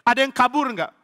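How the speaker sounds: loud, high interrogation; demanding king. Acted. Saul asking soldiers whether anyone escaped, demanding.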